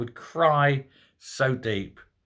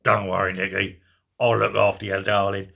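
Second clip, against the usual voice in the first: lower, mock tender cellmate voice; 6 semitones lower than usual. Cellmate comforting Sturgeon in a cell, hammed-up low tender voice. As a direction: ham up a gruff cellmate comforting her. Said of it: "a man putting her arm around her going".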